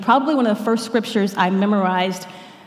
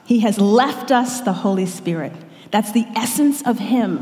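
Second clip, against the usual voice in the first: usual speaking voice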